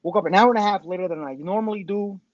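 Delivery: ordinary talk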